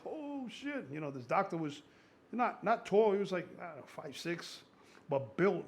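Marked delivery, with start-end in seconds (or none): 0.1-0.9 high mock gasp of awe at a powerful build; a quick stunned curse, pitched up; amazed. Retelling his awe at a strong client, mock amazed. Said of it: "You know, like".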